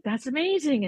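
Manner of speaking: high, bright-eyed amazement; amazed. Retold or imitated. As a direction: marvel at it. Nurse reacting to Jesus visions.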